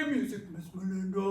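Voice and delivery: fast, goofy silly voice. Shown as a demonstration. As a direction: say it as silly as you can. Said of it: "in your silliest voice".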